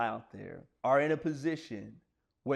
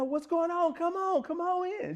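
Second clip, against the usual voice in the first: much higher, sweet coaxing lady's voice; daycare lady. Retold or imitated. Daycare lady at the door, coaxing a crying child inside.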